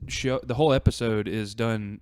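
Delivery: normal talking voice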